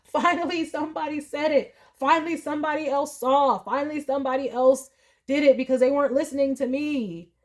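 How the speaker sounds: finally someone gets it, let it spill out; relieved outburst. Retold or imitated. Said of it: "you're gonna be like".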